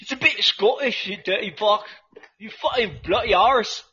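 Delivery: high, mock-aggressive Scottish; hammed-up Scottish brawler; Scottish accent. Teasing back after co-host calls it Scottish, Scottish accent. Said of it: "you see, that's Scottish".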